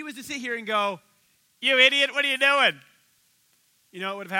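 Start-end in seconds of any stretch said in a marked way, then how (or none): 1.6-2.8 furious shout at a foolish husband; angry yell. Pretend. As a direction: scream it at him, full fury. Wife hypothetically yelling at David.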